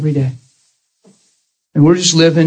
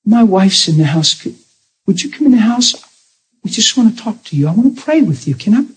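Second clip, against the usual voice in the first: gentle pleading invitation; 6 semitones higher than usual. Retold or imitated. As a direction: coax her inside, pitched well up. Inviting the woman inside.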